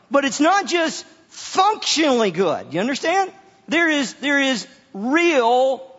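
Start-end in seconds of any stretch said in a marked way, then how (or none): none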